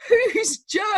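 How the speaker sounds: high, loud, baffled "Who's Jo?"; a clueless newcomer's startled question; clueless blurt. Retold or imitated. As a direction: blurt out "Who's Jo?" in total confusion. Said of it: "And I went".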